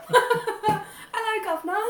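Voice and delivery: high, loud "Hello, Governor" breaking into laughter; laughing cockney. Retold or imitated. Trying the cockney again through her own laughter. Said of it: "Dave Anthony's is like".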